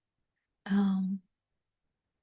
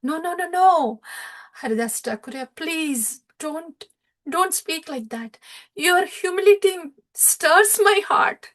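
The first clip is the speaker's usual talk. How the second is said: higher, earnest protest, softening into a plea; gently protesting Lord; 6 semitones higher than usual. Acted. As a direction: stop him lovingly, then beg him kindly. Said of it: "And then Chaitanya Mahaprabhu says".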